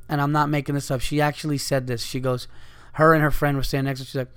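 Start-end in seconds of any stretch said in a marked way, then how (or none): none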